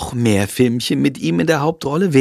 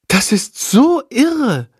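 slow, much higher amazed voice; 11 semitones higher, slower than usual. A narrator's reading. Lilly marvels at the talking bat, slow and high. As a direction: marvel at it slowly. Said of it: "staunt Lilly".

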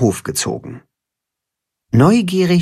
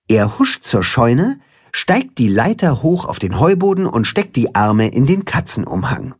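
usual speaking voice

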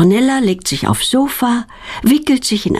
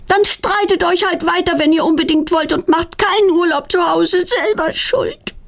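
grumbling; 11 semitones higher than usual. A narrator's reading. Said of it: "verschwindet meckernd".

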